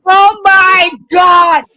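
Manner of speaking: loud, slow laughing cry; loud drawn-out laughing oh my God; laughing shout. Real, not acted. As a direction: howl it while dying laughing. Cracking up at impression.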